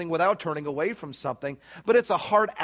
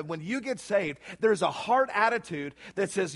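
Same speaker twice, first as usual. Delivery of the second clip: ordinary talk, nothing marked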